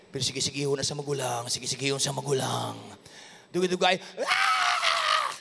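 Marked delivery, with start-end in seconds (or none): none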